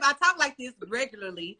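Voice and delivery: ordinary talk, nothing marked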